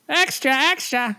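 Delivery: put-on high old-time newsboy call; newsboy shout. Shown as a demonstration. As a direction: hawk papers like a 1930s newsboy. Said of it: "like, a newspaper".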